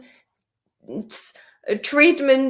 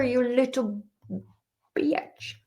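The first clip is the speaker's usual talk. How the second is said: playful whispered jab; whispered insult; 4 dB quieter than usual. Real, not acted. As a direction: drop the word in a cheeky whisper. Teasing insult at the app.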